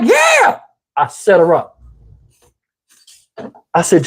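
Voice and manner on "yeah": very high mock-eager squeal; irritating woman. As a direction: squeak it like the annoying lady. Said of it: "voice to people that irritate me".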